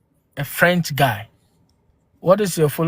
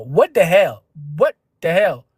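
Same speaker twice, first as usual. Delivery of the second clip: shocked; 3 dB louder than usual. Real, not acted. Reacting in shock to a comment.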